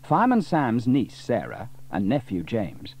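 usual speaking voice

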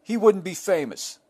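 high whiny fan voice; a hammed-up entitled fan; mock entitled fan. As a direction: whine it like an indignant fan. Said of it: "someone's like".

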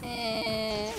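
ordinary talk, nothing marked

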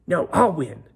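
loud bragging "No, I'll win"; boastful retort. Acted. Ponies argue who wins.

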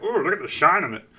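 put-on nerdy delight with swooping pitch; eccentric nerd. Scientist holding up the bar, eccentric delighted voice. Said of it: "He was a little eccentric".

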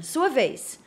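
ordinary delivery

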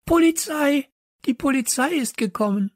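high-pitched, loud alarm shriek. A narrator's reading. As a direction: screech it out to the whole class. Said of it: "kreischte jemand auf".

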